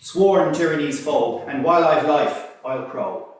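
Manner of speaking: loud, high, proud declamation; declaiming ballad hero. Acted. Frank McNamara introducing himself to listeners, loud defiant declamation. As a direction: proclaim it like a defiant rebel balladeer.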